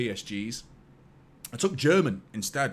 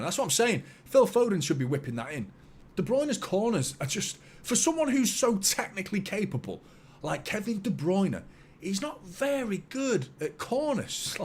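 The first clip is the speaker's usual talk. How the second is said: exasperated complaint; 7 semitones higher than usual. Real, not acted. Griping about poor corners.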